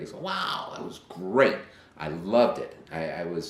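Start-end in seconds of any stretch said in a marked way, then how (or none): 0.2-1.6 excited. Retold or imitated. Dad reacting to son's news.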